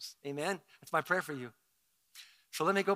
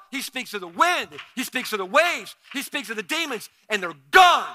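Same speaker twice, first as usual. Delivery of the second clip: loud, high preacher's storytelling; preaching at full force; 6 semitones higher, 11 dB louder than usual. Acted. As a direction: thunder the story to the congregation. Preaching the storm story loudly.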